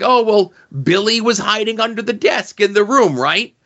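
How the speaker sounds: lightly voiced casual teacher; mock teacher. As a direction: parody her offhand excuse.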